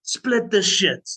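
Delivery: mock yell, high and drawn out; retold scream. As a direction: imitate your own stage scream without full volume, slowly. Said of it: "And I just screamed".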